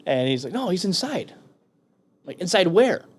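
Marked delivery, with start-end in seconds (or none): 0.5-1.3 high-pitched imitation of the other guy; matter-of-fact correction. Young Marine correcting him about Frank, high and innocent. Acting out the kid's answer. 2.4-3.1 high, louder disbelieving retort; incredulous question. Retold or imitated. Marine questioning the kid about Frank, incredulous.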